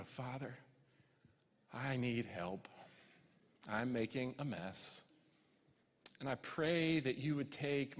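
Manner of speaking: hushed prayer with long pauses; humble prayer. Retold or imitated. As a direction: quietly plead with God for help. Re-enacts his prayer for help.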